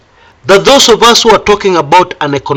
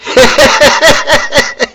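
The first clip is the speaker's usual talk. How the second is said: high rapid giggle; high-pitched incredulous laugh; 9 semitones higher than usual. Real, not acted. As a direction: burst into a long high cackle of disbelief. Laughs at advice he just quoted.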